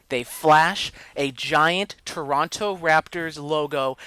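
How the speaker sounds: worked-up, drawn-out complaint; triggered rant. Real, not acted. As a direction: hit each detail with loud outrage. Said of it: "I was triggered".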